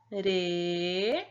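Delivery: long held "re", rising at the end; isolated syllable. Shown as a demonstration. Teacher fills the next box, "re" drawn out slowly. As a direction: sound out "re" alone, stretched. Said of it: "você vai colocar uma sílaba".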